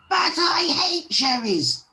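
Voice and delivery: high, loud tantrum yell; furious dwarf outburst, loud and high; angry shout. A narrator's reading. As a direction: yell it in a cross storybook temper. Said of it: "shouted Angry".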